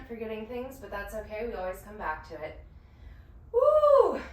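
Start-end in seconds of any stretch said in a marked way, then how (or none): 3.5-4.2 high, loud whoop of encouragement; excited cheer. Real, not acted. Instructor whooping to pump up her class, high and loud.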